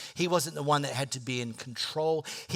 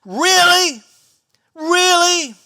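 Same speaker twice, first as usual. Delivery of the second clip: high, loud incredulous really; exasperated really; 13 semitones higher, 13 dB louder, much slower than usual. Retold or imitated. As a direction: cry out in disbelief. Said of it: "I think to myself".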